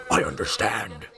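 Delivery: a hint of a low robotic voice; lightly robotic, flat and measured; robotic Batman. Gamer doing a robotic Batman impression. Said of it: "robotic of sorts".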